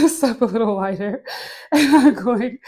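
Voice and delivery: giggle-broken instruction, real laughter; laughing. Real, not acted. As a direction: keep instructing while cracking up. Cracks up at own wide step.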